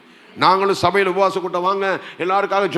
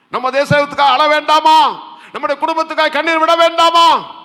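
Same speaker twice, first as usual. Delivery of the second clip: raised, forceful sermon voice; impassioned preaching; 5 semitones higher than usual. Real, not acted. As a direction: press the congregation with urgent appeals. (ஆவேசமான பிரசங்கக் குரல்)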